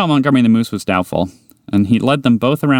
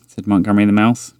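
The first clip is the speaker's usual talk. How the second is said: ordinary delivery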